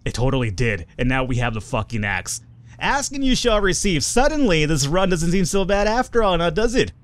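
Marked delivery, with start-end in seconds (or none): 2.8-6.9 high-pitched, elated exclaiming; lifted, gleeful voice; delighted triumph. Real, not acted. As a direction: crow over a lucky find. Delighted at finding the axe.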